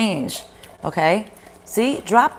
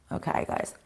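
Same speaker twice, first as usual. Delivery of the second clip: whispered aside; 14 dB quieter than usual. Real, not acted. Aside under breath.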